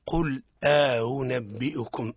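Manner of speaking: slow قل أنبئكم with the hamza eased; model recitation. Shown as a demonstration. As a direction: recite قل أنبئكم slowly for the student to copy. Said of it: "إذا ننطق بها هكذا". (تلاوة نموذجية بطيئة)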